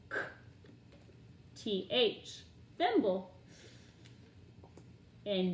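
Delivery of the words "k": k as a bare voiceless click; cluster sound. Shown as a demonstration. Teacher drilling consonant clusters, k as bare click. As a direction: say k with no vowel.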